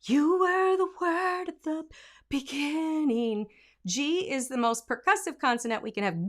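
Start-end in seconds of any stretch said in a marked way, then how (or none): 0.0-3.5 "You" begun with a W glide, slow and high; the airy weak take; breathy W version. Shown as a demonstration. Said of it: "We have a W here".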